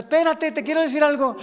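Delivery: high, loud "wait, listen"; exasperated acted interruption; God interrupting. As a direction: shout over someone who keeps talking. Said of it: "Y el Señor".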